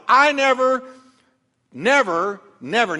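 first never high and loud, then fading; each never hammered, dropping away; self-righteous son boasting to his father; insistent never. Acted. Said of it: "Notice what he's telling the Father".

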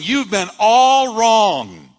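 slow, loud and high, a divine rebuke; booming shout. Acted. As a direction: thunder it down on him slowly. Jesus confronting Saul.

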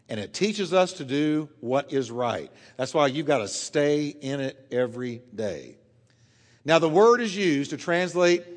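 normal talking voice

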